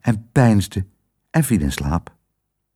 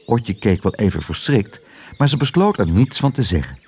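plain reading voice

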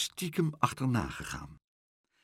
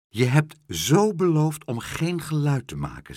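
stern scolding; 6 semitones higher, 4 dB louder than usual. Acted. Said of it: "zei Pluk streng".

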